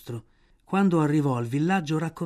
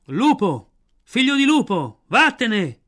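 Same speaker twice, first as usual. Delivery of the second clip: loud, high, separate cries of banishment; priest shouting; 6 semitones higher, 7 dB louder, slower than usual. A narrator's reading. Village priest drives Mowgli away, shouting. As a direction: hurl each cry out loudly. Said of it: "gridò il prete".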